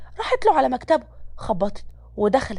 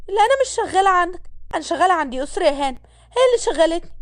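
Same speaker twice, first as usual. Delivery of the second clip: higher-pitched woman's voice, answering back; defiant maid; 6 semitones higher, faster than usual. Acted. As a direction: play the maid standing her ground, pitched up. (خادمة بتتحدى بصوت أعلى نبرة)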